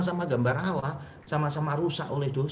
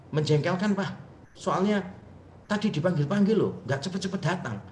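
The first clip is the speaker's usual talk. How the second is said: annoyed complaint; 5 semitones higher than usual. Retold or imitated. Son's annoyed complaint, acted out.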